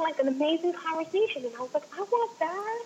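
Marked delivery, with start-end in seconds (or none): 2.0-2.8 longing. Retold or imitated. Wanting what others have.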